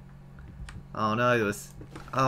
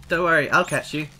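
usual speaking voice

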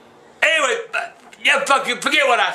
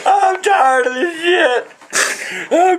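anguished yell; 5 semitones higher, much slower than usual. Real, not acted. Old man to family, slow anguished yell. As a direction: drag it out in despair. Exasperated crying-out.

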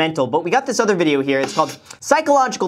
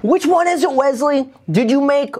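mock confrontation; 7 semitones higher than usual. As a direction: call him out indignantly. Confronting Wesley mockingly.

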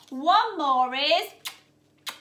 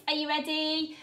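ordinary delivery

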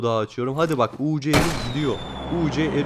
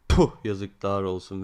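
disappointed; 7 semitones lower than usual. Real, not acted. Crate gives nothing again.

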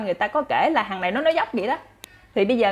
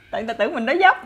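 laughing; 4 semitones higher, 3 dB louder, much faster than usual. Real, not acted. Laughs at own story.

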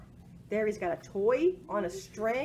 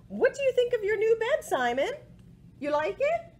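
high, fast pet-talk; owner talking to dog; 12 semitones higher, 4 dB louder than usual. Acted. Owner asking Simon about his new bed, sweet pet-talk.

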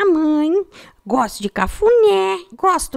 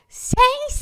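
calling out; 7 semitones higher than usual. Retold or imitated. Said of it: "ela gritou".

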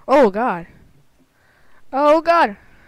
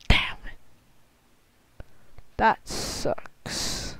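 soft unvoiced curse; whispered curse; 9 dB quieter, much slower than usual. Real, not acted. After failing the level, soft whispered curse. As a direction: breathe it out under your breath.

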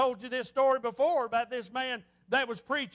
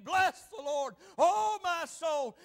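slow, stretched recitation, falling away at the end; proclaimed psalm. Shown as a demonstration. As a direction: draw out "oh my soul". Preacher recites psalm with congregation.

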